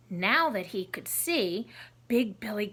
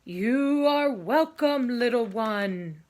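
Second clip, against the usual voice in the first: thunder voice; 5 dB louder, slower than usual. Acted. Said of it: "a voice like thunder answered".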